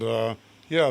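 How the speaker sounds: normal talking voice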